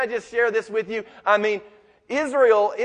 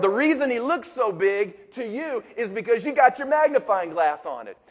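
ordinary talk

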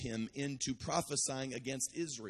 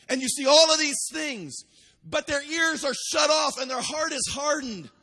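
loud, higher shouted preaching climax; full-force preaching; 9 semitones higher, 8 dB louder than usual. Acted. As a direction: let the heartbreak burst out to the congregation.

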